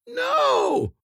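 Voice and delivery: very high, piercing scream; piercing shriek. A narrator's reading. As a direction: shriek it with all effort. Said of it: "She gave a piercing shriek".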